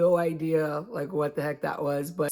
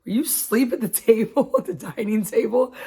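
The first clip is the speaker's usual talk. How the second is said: higher, amused teasing, a laugh breaking through; mock the sister catching her asleep, half laughing; sister's teasing quote; 6 semitones higher than usual. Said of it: "my sister walked by and she goes".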